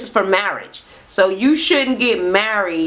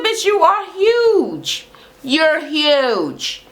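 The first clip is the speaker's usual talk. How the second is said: high, drawn-out retold insult; emphatic mocking; 7 semitones higher, much slower than usual. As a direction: stretch HUGE out high, then let it fall. Retold blunt insult in quarrel.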